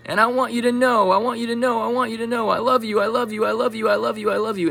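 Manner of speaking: high, insistent voice of the cat; cat's voice. Retold or imitated. Vlogger voicing her cat Tigger, high and insistent. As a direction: say it over and over like a cat. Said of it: "what if he's actually saying".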